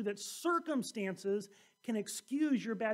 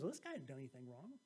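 offhand verdict; 9 semitones lower, 14 dB quieter, faster than usual. Acted. Pilate's verdict voiced.

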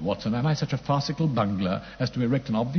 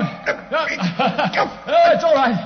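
shouting in a struggle; 10 dB louder than usual. Acted.

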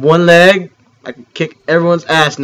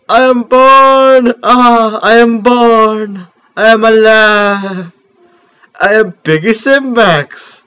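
high, loud, slow roaring declaration; triumphant newborn beast; 6 semitones higher, 5 dB louder, slower than usual. Acted. Gamer voicing his newborn cube creature, triumphant bellow. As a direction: bellow your birth to the world.

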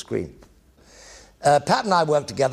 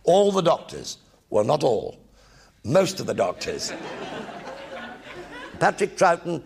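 usual speaking voice

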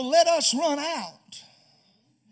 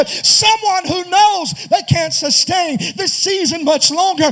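preaching at full force; 5 dB louder than usual. Real, not acted. Preacher calling the weary congregation, full force. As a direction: lift the house with the altar call. Sermon climax appeal.

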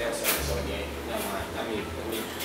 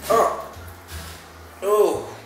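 surprised; 7 semitones higher than usual. Real, not acted. Reacting to the sauce smell.

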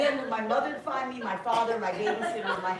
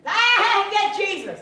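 high loud shouted cry; high-pitched comic scream of joy; mock scream; 9 semitones higher, 9 dB louder, much slower than usual. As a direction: yell it like a kid overcome. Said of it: "starts screaming".

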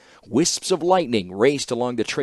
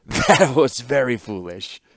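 slow, still laughing through the words; amused; 2 semitones higher, 4 dB louder, slower than usual. A narrator's reading. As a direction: savour the joke while toasting. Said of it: "offered his cup and a toast".

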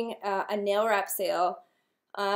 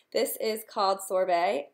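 ordinary delivery

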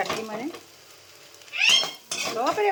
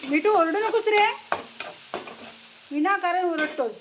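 scolding call; 8 semitones higher than usual. Real, not acted. Scolding the noisy parrot.

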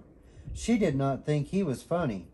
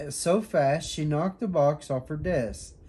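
ordinary delivery, nothing marked

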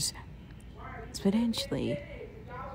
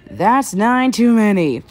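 loud taunt; 2 semitones higher, 9 dB louder than usual. Acted. Taunt in combat.

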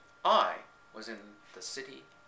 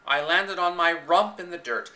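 plain reading voice

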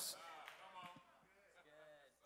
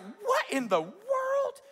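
slow, high-pitched mock astonishment; flabbergasted; much slower than usual. Townsperson coming out of the house, flabbergasted. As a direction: stretch it out in comic disbelief. Acts waking to wrecked idols.

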